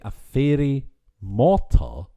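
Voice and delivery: "a fairy mortar" drawn out loud, word by word; "fairy mortar" slow, loud and questioning; baffled query. Retold or imitated. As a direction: slowly puzzle out "a fairy mortar", disbelieving. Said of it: "And I thought, "Wait, is that".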